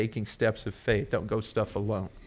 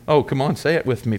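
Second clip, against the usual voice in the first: usual speaking voice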